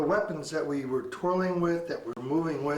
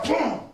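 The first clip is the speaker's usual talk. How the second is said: high, loud boom; explosive vocal boom; imitated impact; 8 semitones higher, 5 dB louder than usual. Shown as a demonstration. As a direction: burst in with the strike noise. Imitates the counter strike landing.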